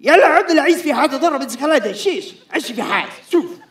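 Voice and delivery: high, loud, very fast Arabic chatter. Retold or imitated. Man on the phone rattling fast Arabic at the audience.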